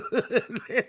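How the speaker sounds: a quick amused laugh; short laugh. Real, not acted. Streamer teasing a rival streamer, short laugh. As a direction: let a little laugh slip.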